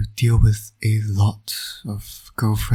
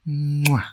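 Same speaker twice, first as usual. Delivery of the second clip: put-on kiss for the baby; 6 semitones higher than usual. Villain dad kissing his baby son, smacking kiss. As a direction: plant a big smooch on the little guy.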